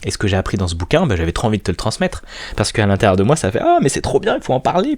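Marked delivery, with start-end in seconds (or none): 3.6-5.0 sudden high-pitched delight; excited inner voice. Retold or imitated. Telling the listener how the book thrilled him, high excited gush. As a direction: burst out like you can't hold it in.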